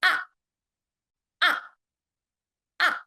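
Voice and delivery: "Ah" dropping sharply from high; short, forceful falling "Ah"; fourth tone. Shown as a demonstration. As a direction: start "Ah" high and drop it at once. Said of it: "starts high and then immediately goes down".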